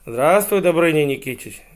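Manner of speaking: very polite hedgehog. Acted. Hedgehog greets a hero on the road, very politely. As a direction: hail him with exaggerated courtesy. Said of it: "жил очень вежливый ёжик".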